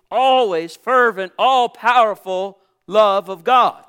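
preacher building to a full-voice peak; building sermon fervour. Acted. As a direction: climb toward the final word of praise.

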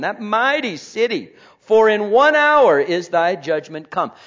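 reading Revelation out loud with force; scripture reading. Acted. Preacher reading scripture to the congregation, loud. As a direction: proclaim the verses from the pulpit.

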